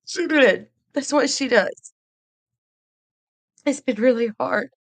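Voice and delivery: high voice choked with tears; fighting back crying while talking; real tearful. Real, not acted. Streamer telling viewers it's been rough, tearful. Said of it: "and I hate crying".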